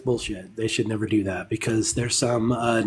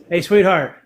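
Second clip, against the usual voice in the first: raised, louder call to a partner; higher, loud holler across the house; 5 semitones higher, 7 dB louder than usual. Real, not acted. Host calls to his wife, calling out.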